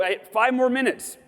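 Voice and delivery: high, exasperated grumble broken by a laugh; mock pissed-off griping. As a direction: play up your annoyance at the timer. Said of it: "And I go".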